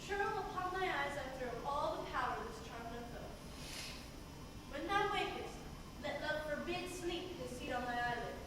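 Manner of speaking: soft, hushed voice over sleepers; hushed spell. Acted. Puck sneaks over sleeping lovers.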